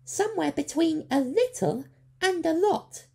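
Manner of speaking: very high, loud, puzzled squeak; innocent creature. A narrator's reading. Creature asking the way, innocent. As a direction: ask it all innocence. Sproggle directing.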